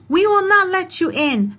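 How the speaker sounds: high, loud, defiant yell; yelling pigs. Acted. Pigs yelling back at the wolf, high and loud. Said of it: "the pigs yelled back".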